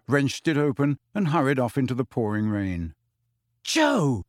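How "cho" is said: higher, called out; Harry calling after Cho; calling after her. A narrator's reading. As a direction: call to someone leaving. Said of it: "Harry called after her".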